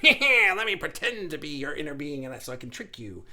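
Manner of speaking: mock sneaky cackle into trickster voice; scheming ego. Ego pretending to be inner being, hammed-up trickster. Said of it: "is going like".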